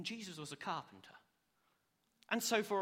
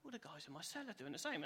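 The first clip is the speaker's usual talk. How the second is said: sulky aside; 9 dB quieter than usual. Retold or imitated. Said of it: "I'll look a bit sulky".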